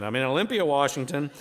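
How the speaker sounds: usual speaking voice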